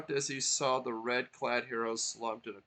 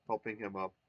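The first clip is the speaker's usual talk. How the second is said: ordinary delivery, nothing marked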